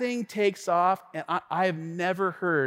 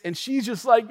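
normal talking voice

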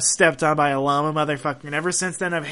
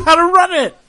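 shouting; 11 semitones higher, 12 dB louder than usual. Real, not acted. Ranting about a mismanaged company.

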